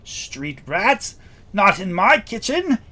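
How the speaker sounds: high, loud indignant gnome cook; blustering. Acted. Gnome cook offended at street rat remark, blustering. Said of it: "He sort of blusters and says".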